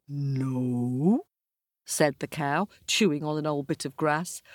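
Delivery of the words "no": slow, lightly voiced cow. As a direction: refuse calmly. Cow refusing.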